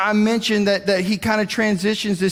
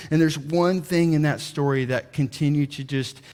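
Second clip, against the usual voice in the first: ordinary delivery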